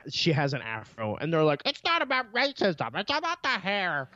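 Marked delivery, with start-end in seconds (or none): 1.7-4.1 high, whiny nerd voice. Retold or imitated. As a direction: play a defensive whiny nerd. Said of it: "my impression of a nerd".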